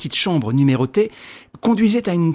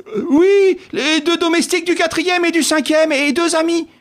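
concierge voice; 12 semitones higher than usual. Acted.

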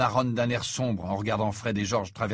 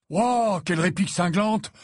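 high, exclaimed sarcastic awe; mock admiration; 7 semitones higher, 4 dB louder than usual. A narrator's reading. Fred comments on Ron's retort, in mock admiration. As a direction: pretend to be hugely impressed.

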